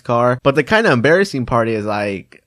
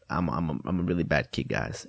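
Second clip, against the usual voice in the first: much lower and softer, deflated; mock despair; 8 semitones lower, 11 dB quieter than usual. Caught kid admitting guilt to viewers, deflated mock despair. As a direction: sink into a mock sob of self-pity.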